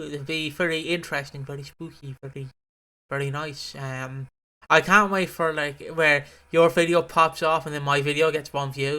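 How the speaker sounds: ordinary talk, nothing marked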